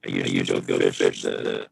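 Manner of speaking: glitchy low robotic voice; distorted robot voice over a bad line. Real, not acted. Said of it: "Robot voice".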